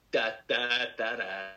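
ordinary talk, nothing marked

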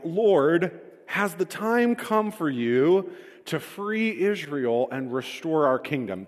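high, slow, pleading question to the Lord; eager disciples pleading. Retold or imitated. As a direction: ask it like an impatient follower begging for the moment. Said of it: "they kept asking him".

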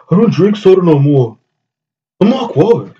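1 mocking voice. Mocking a listener's voice.

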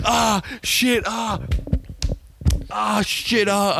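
high-pitched cry of pain; high pained yelp. Retold or imitated. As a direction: yelp it out high like a hurt kid. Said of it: "We're like".

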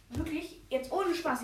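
usual speaking voice

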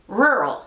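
"Rural" as RUR-ul, shorter, American R; model pronunciation. Shown as a demonstration. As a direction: model "Rural" cleanly in one glide. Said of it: "Pero a ver, escuchen".

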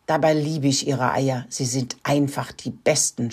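plain reading voice

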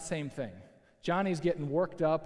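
normal talking voice

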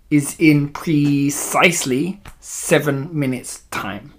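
put-on slow, precise villainess with a foreign accent; accented witch, dropping away at the end. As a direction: draw out the countdown as an accented witch.

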